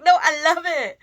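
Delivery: loud, high imitated exclamation; sister's enthusiastic approval. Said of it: "and then she's like".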